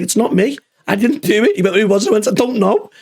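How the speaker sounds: a light, high fake denial; mock innocence. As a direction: act falsely innocent, wide-eyed. Feigning innocence in retold prank.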